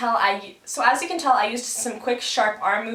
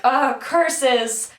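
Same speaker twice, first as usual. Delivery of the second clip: hammed-up shaking fist, a bit higher; mock curse; 4 semitones higher, 4 dB louder than usual. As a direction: shake a fist at the sky. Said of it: "pointing up at the earth like".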